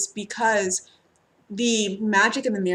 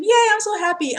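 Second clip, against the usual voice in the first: high, louder gushing yay; mock cheer; 11 semitones higher, 5 dB louder than usual. Reader cheers a client's news, high gushing yay. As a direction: cheer it like a delighted friend. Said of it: "Or they come to me and be like".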